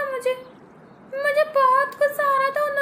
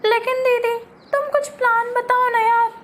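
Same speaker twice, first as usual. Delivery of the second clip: ordinary talk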